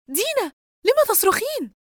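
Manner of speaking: high, loud, panicked call; alarmed. A narrator's reading. Yusra hears Dina scream and calls out, panicked. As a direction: cry out in alarm. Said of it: "يسرى بجزع".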